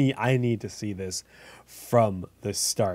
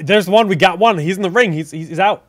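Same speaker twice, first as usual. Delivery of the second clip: higher, louder sudden hype; excited call; 7 semitones higher, 11 dB louder than usual. Real, not acted. Caster cheering the AFK player coming back, excited. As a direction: perk up as the player finally moves.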